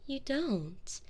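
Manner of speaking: ordinary talk, nothing marked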